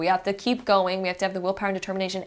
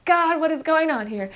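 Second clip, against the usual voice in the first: struggling complaint; 8 semitones higher, 3 dB louder than usual. Acted. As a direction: act out straining under a heavy weight. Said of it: "you might be sitting there struggling".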